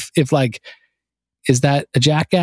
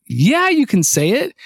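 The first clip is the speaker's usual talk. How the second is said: encouraging; 9 semitones higher, slower than usual. Retold or imitated. Boss permitting the cuss word.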